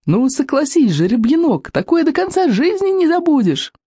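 high, exhilarated yell over roaring wind. A narrator's reading. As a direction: cry out high in delight at the speed. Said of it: "орала Камикадзе сквозь рёв ветра". (восторженный крик сквозь рёв ветра)

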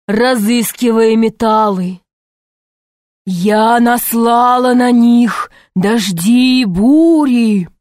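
slow, measured snake speaking; calm giant snake, storytelling. Acted. Snake tells her story.

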